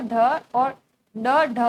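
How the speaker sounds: ढ and ड said slowly and loudly, each letter drawn out; slow, loud letter demo; letter sounds demo. Shown as a demonstration. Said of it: "का उच्चारण करो".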